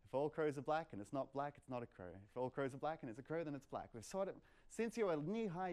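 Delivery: ordinary delivery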